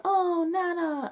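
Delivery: put-on high, slow, sorrowful child voice; children's cry. The children calling after Nana as she's sent away, high and pleading. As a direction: wail it like the Darling children losing their dog. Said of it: "and they'll go".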